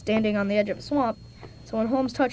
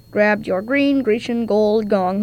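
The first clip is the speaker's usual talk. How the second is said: slow and deliberate; 4 dB louder, slower than usual. Acted. Slow tongue-twister recap.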